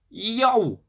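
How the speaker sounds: high, loud holler. A narrator's reading. Baba Yaga points at the dog, loud holler. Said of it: "she hollered".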